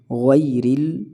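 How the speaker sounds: "غیرل" with ghai closing on y; ghay-ril for "غیرل", tight glide; clear ghai. Shown as a demonstration. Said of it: "اس طرح پڑھا جائے گا". ("غیرل" غی کے ساتھ)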